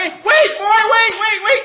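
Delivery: high, drawn-out yell to halt; frantic shout. Retold or imitated. Crew calling back the running jumper, frantic shout. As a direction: holler after someone running off.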